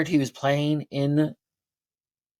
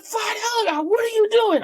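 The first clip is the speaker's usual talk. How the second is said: very high, louder, crying out in panic; panicked crying shout; 4 dB louder than usual. Retold or imitated. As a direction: wail it like the helicopter is going down. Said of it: "It's like".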